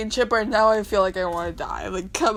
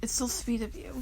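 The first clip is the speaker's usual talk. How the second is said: soft adoring whisper; 3 semitones higher, 8 dB quieter than usual. Real, not acted. Reader whispering over a sweet comic line. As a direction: breathe it out tenderly.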